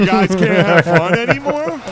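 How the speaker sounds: loud, high, drawn-out complaint; indignant dad imitation. Son mocking his dad's new politics, loud high complaint. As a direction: whine it loudly and slowly, mocking dad. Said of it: "He's like".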